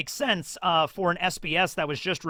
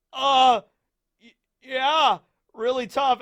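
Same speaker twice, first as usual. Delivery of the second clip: higher, stammering mock-scared yes; nervous agreement; 8 semitones higher, 5 dB louder, slower than usual. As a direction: agree shakily, scared of the bear.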